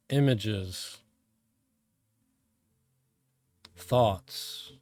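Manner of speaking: calm, measured meditation voice with long pauses; guided meditation. Real, not acted. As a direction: guide them inward, phrase by phrase, letting each one settle.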